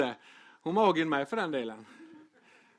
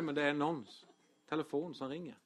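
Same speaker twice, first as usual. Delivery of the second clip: ordinary talk, nothing marked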